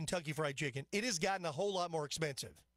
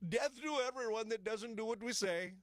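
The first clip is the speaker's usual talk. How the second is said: mocking Iranian accent, pitched up; Pezeshkian in mock Iranian accent; 6 semitones higher than usual. Radio host making fun of how they operate, in a mock Iranian accent. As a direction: parody a threat in a high Iranian accent. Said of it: "making fun of the way they operate".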